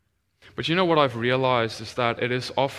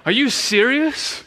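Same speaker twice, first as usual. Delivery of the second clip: high, loud acted disbelief; incredulous; 11 semitones higher, 6 dB louder than usual. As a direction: ask it in loud, high astonishment. Acts out own disbelief at healing.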